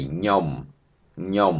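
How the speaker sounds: ខ្ញុំ said as nyom, no k sound; ខ្ញុំ without the initial k, just nyom; spoken form. Shown as a demonstration. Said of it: "No need to pronounce K".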